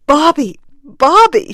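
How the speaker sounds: high, loud cry of grief; anguished cry. A narrator's reading. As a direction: cry out the name in helpless sadness. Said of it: "she cried out in a release of unreasoning sadness".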